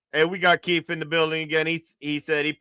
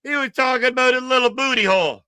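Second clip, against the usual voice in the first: usual speaking voice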